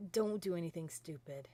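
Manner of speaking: ordinary talk, nothing marked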